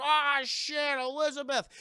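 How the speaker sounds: alarmed shout. Acted. Onlookers seeing her fall.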